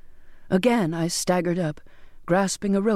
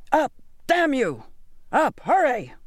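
screamed order; 6 semitones higher than usual. A narrator's reading. Captain drives sailor up the rigging, restrained scream. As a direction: drive them upward with a high-pitched cry. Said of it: "Captain Jaggery screamed".

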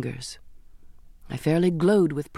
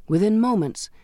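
usual speaking voice

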